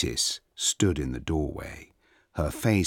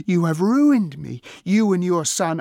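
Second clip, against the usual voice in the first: very high, loud wail of outrage; furious accusation; 13 semitones higher, 9 dB louder than usual. A narrator's reading. Princess accuses the goddess of ruining her, furious. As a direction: cry out as one wronged.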